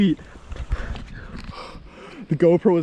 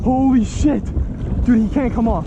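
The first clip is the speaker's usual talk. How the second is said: excited; 7 semitones higher than usual. Real, not acted. Landing a huge fish.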